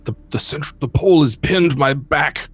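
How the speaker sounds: high, strained cry for help from a pinned goblin; trapped hobgoblin. Acted. Game master as trapped hobgoblin calling to the party, high halting plea. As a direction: plead in halting bursts, pitched well up. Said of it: "It's Hobgoblin's voice".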